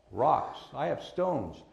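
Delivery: ordinary delivery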